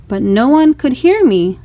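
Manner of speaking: ordinary delivery, nothing marked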